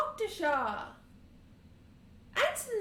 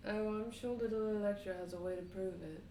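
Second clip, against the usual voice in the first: low, soft, fast aside; hinting there's proof; knowing voice; 10 semitones lower, 5 dB quieter, faster than usual. Acted. Player hints Electra can prove it, low and quick.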